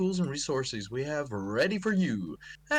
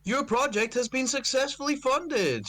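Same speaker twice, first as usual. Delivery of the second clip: high announcer voice; 11 semitones higher than usual. Acted. Reading the Kickstarter email aloud.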